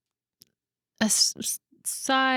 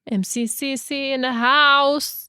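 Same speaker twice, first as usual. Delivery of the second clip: drawn-out, raised hype-MC call; MC announcement; 5 semitones higher, 4 dB louder, slower than usual. Pretend. Friend trying out her old high school MC name, playful MC call.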